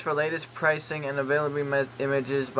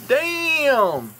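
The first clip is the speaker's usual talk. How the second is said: excited exclamation; 11 semitones higher, 5 dB louder than usual. Real, not acted. Impressed by a mask picture.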